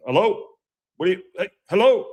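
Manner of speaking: high, loud calls of hello at the door; hammed-up player calling through the manager's door; mock knocking visitor. Players crowding the manager's office door, calling hello loudly.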